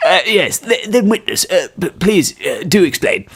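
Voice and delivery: halting old judge, lightly voiced. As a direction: stammer like a doddering judge. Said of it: "This is the judge voice".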